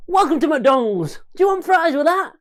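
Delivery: hammed-up drive-thru cashier; fast-food worker. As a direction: greet customers at the till. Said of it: "my impression of me after the lockdown".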